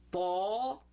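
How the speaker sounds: tall drawn out, no L, rising; modeling the sound. Shown as a demonstration. Teacher has pupils repeat a new letter, modeling it drawn out. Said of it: "Let's say that. Say".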